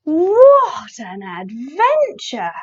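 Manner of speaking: gasp, then slow, swooping amazement; big storybook marvelling; amazed gasp. A narrator's reading. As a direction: draw it out in theatrical wonder.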